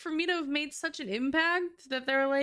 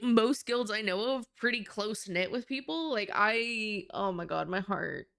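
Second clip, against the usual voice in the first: ordinary talk, nothing marked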